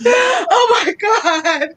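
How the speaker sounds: high, loud gasp of disbelief; stunned oh my God; shocked outcry. Real, not acted. Shocked reaction, cry shout heard.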